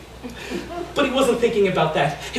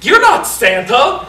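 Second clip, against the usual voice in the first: blurted outburst; 3 semitones higher, 6 dB louder, slower than usual. Acted. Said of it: "the words flew out of his mouth".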